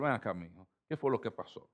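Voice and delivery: put-on soft, low, calm invitation to a child; gentle parent. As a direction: coax your son to talk, voice well down. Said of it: "comenzar a hablar con él de otra manera". (padre hablándole suave a su hijo)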